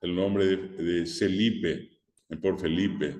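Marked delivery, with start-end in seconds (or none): none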